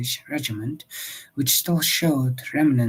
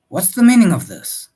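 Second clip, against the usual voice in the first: loud, higher, affronted challenge; indignant demand; 7 semitones higher, 12 dB louder than usual. Acted. Husband confronting armed riders at night, loud and indignant. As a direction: demand an explanation, outraged. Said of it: "Mister Parker said".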